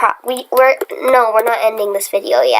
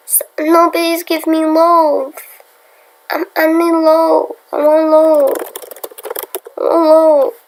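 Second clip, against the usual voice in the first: higher, louder whiny begging; mock whining; 5 semitones higher, 3 dB louder than usual. Vlogger whining to the game for loaf. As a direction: wail for loaf like a spoiled kid.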